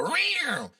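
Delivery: high, drawn-out feeble kitty roar; prancing little tiger. Acted. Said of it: "what the little Bengal mascot looks like".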